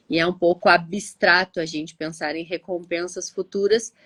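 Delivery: ordinary talk, nothing marked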